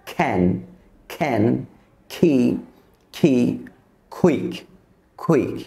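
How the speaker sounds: can, key, quick begun as k-h, with a puff of air; isolated can, key, quick with an airy k release; aspirated k. Shown as a demonstration. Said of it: "সেখানে উচ্চারণ করব খ".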